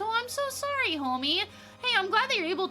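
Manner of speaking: sympathetic. Real, not acted. Sympathy to struggling viewer.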